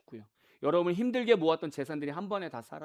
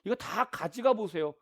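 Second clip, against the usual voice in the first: scoffing devil; 3 semitones higher than usual. Acted. Devil challenging God about Job's faith, scoffing. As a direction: scoff as the devil, stretching the words. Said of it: "마귀가 뭐라고 해요?".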